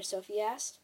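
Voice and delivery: ordinary delivery, nothing marked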